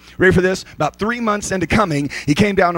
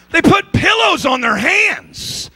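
"pillows" shouted much higher and slower; shouted, stress on pillows; 11 semitones higher, slower than usual. Shown as a demonstration. Preacher repeating the line to the crowd, shouting "pillows" high and slow. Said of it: "'Cause they put pillows on their hands".

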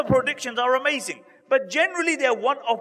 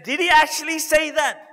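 surprised question; 6 semitones higher, 6 dB louder than usual. Retold or imitated. Abu Bakr checks the claim.

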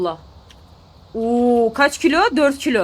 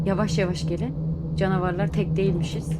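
hushed; 6 semitones lower, 6 dB quieter than usual. Real, not acted. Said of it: "Durun, sessiz olun".

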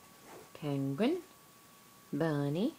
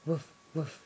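low dog woof; 6 semitones lower than usual. Retold or imitated. Parent to baby, low woof. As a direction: bark it like a dog.

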